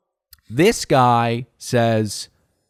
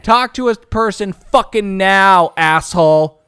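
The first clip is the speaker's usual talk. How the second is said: slow, louder, higher demand; retold yell; 5 semitones higher, 6 dB louder than usual. Caller demanding a human from the automated line, slow angry yell. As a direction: spit it at the phone tree, fed up. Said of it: "yell at an automated person".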